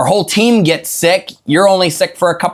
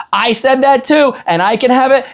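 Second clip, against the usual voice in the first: put-on eager little girl; 8 semitones higher than usual. Girl retells her own story.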